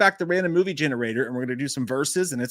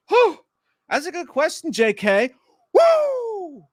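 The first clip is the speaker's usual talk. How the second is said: excited; 4 dB louder, slower than usual. Acted. Hyped high shout-out.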